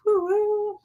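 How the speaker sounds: high sing-song woo-woo; mock cheer. Real, not acted. Mock cheer after editing.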